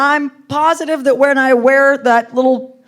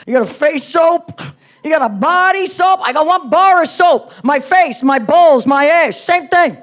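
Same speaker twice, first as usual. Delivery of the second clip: higher-pitched, griping New Yorker; grating New York accent; 3 semitones higher than usual. Retold or imitated. Boyfriend griping about her soaps, grating New York accent. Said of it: "that voice started to get grating".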